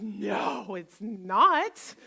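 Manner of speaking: loud, indignant voice of the brain; loud, insistent self-talk, acted out; nagging inner critic. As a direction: scold like a bossy inner critic, hammering the points. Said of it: "my brain goes".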